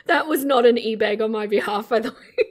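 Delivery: talking through real laughter; laughing. Real, not acted. Laughing as she thanks a donor, giggling.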